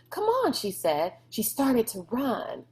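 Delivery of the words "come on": high, bright call to hurry; eager urging. A narrator's reading. Sistine urging Rob to run to the cage, eager. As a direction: pull him along with excitement.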